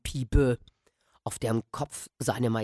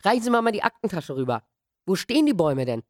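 put-on hurried boy; 7 semitones higher, faster than usual. Boy over fence, hurried.

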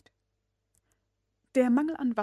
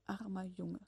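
soft, sympathetic murmur; 12 dB quieter than usual. A narrator's reading. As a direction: murmur it with pity. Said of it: "murmelte er".

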